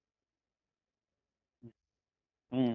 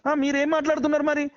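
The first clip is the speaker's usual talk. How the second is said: high, heated comeback; angry retort; 7 semitones higher than usual. Real, not acted. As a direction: throw the accusation straight back, pitched high. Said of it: "ఎందుకు అరుస్తున్నారు". (కోపంగా గొంతు పెంచి ఎదురు ప్రశ్న)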